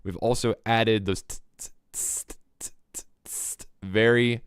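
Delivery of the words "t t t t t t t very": sharp voiceless "t" hi-hat ticks; mouth-made sharp percussion; imitated hi-hats. Shown as a demonstration. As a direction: tick out crisp hi-hat hits with the tongue. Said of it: "very sharp, uh, snare drum sounds".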